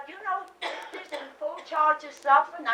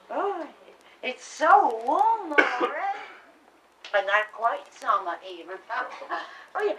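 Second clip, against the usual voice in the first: ordinary talk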